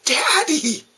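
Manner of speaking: put-on high, exasperated child's protest; indignant little girl. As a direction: whine his name in disgust. Daughter scolds dad's joke.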